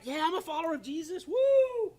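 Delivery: high, quick, hammed-up enthusiasm ending in a whoop; mock cheerful believer. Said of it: "It's like".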